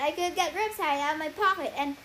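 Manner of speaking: usual speaking voice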